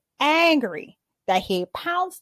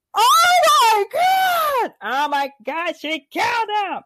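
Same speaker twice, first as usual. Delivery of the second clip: horrified shriek; 11 semitones higher, 4 dB louder, slower than usual. Real, not acted. Reacting to the character's death.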